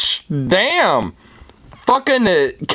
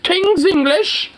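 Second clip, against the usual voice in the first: mock old hag; 12 semitones higher than usual. Mocking the woman in the video, high and sneering. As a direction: lampoon her in a raised mocking voice. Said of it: "It's like, fucking old hag".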